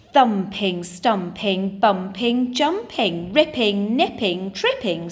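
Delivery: rhythmic, punched list of rhyming verbs; action poem recital. Shown as a demonstration. Teacher reading an action poem to Year two, rhythmic recital.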